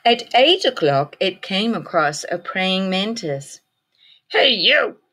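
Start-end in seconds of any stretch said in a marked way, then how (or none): 4.3-5.0 grouchy ladybug voice. A narrator's reading. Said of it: "said the grouchy ladybug".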